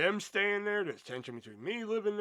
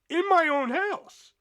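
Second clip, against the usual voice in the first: outraged; 13 semitones higher, 10 dB louder, slower than usual. Real, not acted. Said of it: "threatened with knife, outraged".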